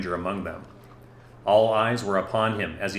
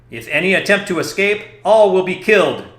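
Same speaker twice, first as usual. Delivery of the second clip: high, loud threatening shout; crying out a threat; 10 semitones higher, 10 dB louder than usual. Acted. Commander threatening the villagers, loud shout. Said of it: "Darstad cried out".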